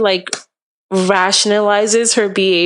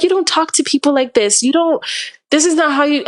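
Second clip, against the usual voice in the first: retold livid scolding; 6 semitones higher, faster than usual. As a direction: re-enact scolding a friend for bad behavior. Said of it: "I was livid".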